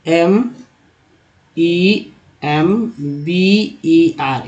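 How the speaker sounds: extremely slow letter spelling. Shown as a demonstration. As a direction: spell each letter out, very slowly. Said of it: "आर लिखेंगे". (बहुत धीरे-धीरे spelling)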